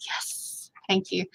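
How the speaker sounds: breathy near-silent yes, thank you; whispered thanks. Retold or imitated. Answering impressed bosses in a timid whisper. Quoting her own meek reply.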